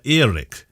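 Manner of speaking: "Erik" sounded out as spelt with two E's; tentative pronunciation of Erik. Shown as a demonstration. Host puzzling over a listener's name, sounding out "Erik". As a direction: try out "Erik" with a long first vowel. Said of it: "how do you say Eric when".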